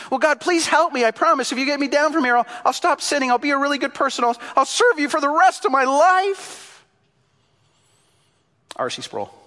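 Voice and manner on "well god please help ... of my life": high, loud bargaining for life; desperate pleading. Acted. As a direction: beg frantically, promises tumbling out. Jack pleads, bargains with God.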